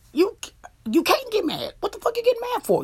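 high-pitched, incredulous scolding, rushing the question; exasperated rebuke. Real, not acted. Vlogger telling cheaters they can't get mad, high exasperated rebuke. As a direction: pitch leaping high, indignant.

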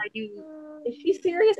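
mock shocked "is she serious"; high, disbelieving aside; incredulous. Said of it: "And I was just".

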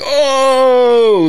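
loud high oh of shock; pained gasp. Retold or imitated. Actor shot in the back, loud high gasp. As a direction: cry out as if hit.

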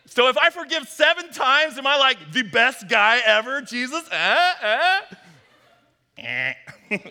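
high, loud, fishing for praise; eager Peter. Retold or imitated. Peter brags to Jesus, high and loud. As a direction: pitch it up like a kid wanting a gold star.

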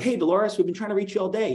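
mock-cheery agent on the phone; chirpy sales call. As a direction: play the eager agent ringing a client. Agent calling client by phone.

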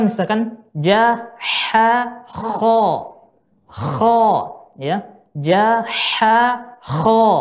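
ja, ha, kho said slowly and clearly; Arabic letter demo. Shown as a demonstration. Teacher demonstrating letters to the students, slow and clear. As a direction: spell the letters out one by one. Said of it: "Seperti saya contohkan".

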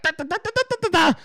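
high-pitched rattled-off da-da-das; mock nervous chatter; rapid-fire babble. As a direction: imitate yourself spouting facts as a high stream of syllables. Imitates own rapid interview chatter.

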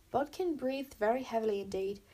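ordinary delivery, nothing marked

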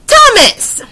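high, drawn-out announcement; excited reveal. Real, not acted. Reader announces the next story to viewers, high excited reveal. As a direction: sing out the name like a big reveal.

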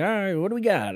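high, swooping game-spinner voice; sick wheel spinner. Acted. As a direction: crow it as you spin the wheel. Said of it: "like some fucking sick fuck just spins a wheel".

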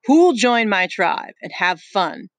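chief Jack calling out slowly; lording it over the boys; slow, loud challenge. Acted. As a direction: draw it out loud to the crowd. Jack ignores Ralph.

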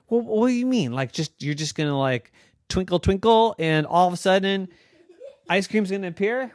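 put-on high, quick, excited little girl voice; girl Noah. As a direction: squeak it out like an eager young girl. Noah mocking the magic.

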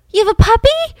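high, loud squeal of delight; excited kid. Retold or imitated. Candy squealing to Betty about her new puppy, childlike excitement.

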